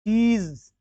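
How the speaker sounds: चीज as high, drawn-out "cheez"; long ee. Shown as a demonstration. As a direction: stretch the vowel of चीज. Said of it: "चीज होता है सही".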